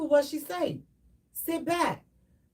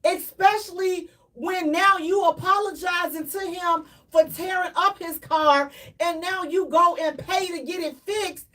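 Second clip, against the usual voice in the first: ordinary delivery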